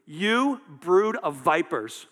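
slow, loud condemnation; measured, forceful scolding; harsh rebuke. Acted. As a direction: draw out each word as a brutal accusation. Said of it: "it's pretty brutal".

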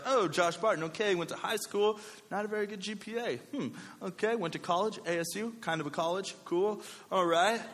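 high-pitched musing employer; mock-polite appraisal, pitched high; resume reviewer. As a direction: muse aloud while skimming a resume. Employer reviewing his resume, acted.

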